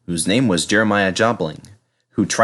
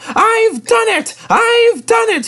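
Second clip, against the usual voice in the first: high, loud, slow cry of triumph; triumphant; 5 dB louder, slower than usual. Acted. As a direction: crow in victory at the top. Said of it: "he puffed triumphantly".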